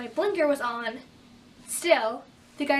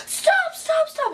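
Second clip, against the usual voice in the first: mock yell, much higher and louder; imitating a screaming examiner; 7 dB louder, much slower than usual. Examiner yelling at teen parking, mock high scream. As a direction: shriek stop like he's braking hard. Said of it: "he was screaming".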